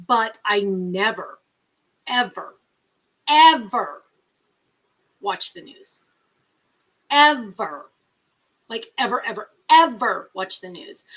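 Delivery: each ever punched loud and falling; emphatic never ever. Real, not acted. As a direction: hammer every ever. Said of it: "emphatic repeated ever".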